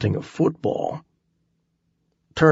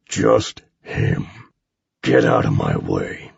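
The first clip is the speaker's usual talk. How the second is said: deep menacing giant; low giant voice. A narrator's reading. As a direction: rumble it like a huge brute.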